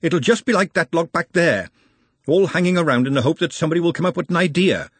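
high, impatient complaint; exasperated Glenda. Acted. Glenda dismisses the idea of telling anybody, exasperated. Glenda carries on her retort.

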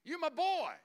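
loud, high, buddy-style cheering; Jesus cheering on Abel, loud and excited; excited praise. Acted. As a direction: hype him up like a proud friend. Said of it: "He's like".

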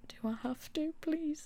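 put-on high, childlike whimper of a plea; whiny plea. To the doctor, a high whining please. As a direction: beg like a kid sent to detention. Begging not to go to A&E.

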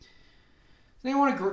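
ordinary talk, nothing marked

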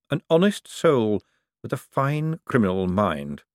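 slow, deliberate patrician musing; slow, musing Vetinari. Acted. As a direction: weigh every word, amused and knowing. Vetinari muses to his secretary.

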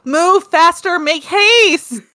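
high, loud urging; screaming at a spider. Real, not acted. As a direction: yell at it to hurry. Yelling at slow figures.

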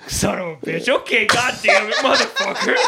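imitated dad grumbling curses; exasperated father. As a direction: curse in mock irritation, climbing as you go. Acted exasperated father getting up.

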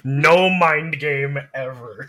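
slow, loud, laughing mock boast. Streamer jokes to co-host in a slow, loud laughing boast. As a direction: drag it out loud through a laugh. Joking about mindless play.

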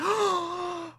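high, soft drawn-in gasp; smitten gasp. Retold or imitated. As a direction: gasp as if struck by love. Acts Sanji smitten seeing Robin.

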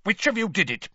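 high, snapping accusation; snapped. Acted. Mister Crouch facing the three children, snapped. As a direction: snap it accusingly. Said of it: "he snapped".

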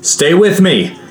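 a sudden high shout over the crowd. A narrator's reading. Leader shouting to companions in the crush, high urgent shout. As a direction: yell it out, rallying the group. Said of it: "I shouted to the others".

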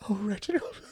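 high, tearful sob of his name; sobbing. A narrator's reading. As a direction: sob it like a distraught mother. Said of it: "she sobbed".

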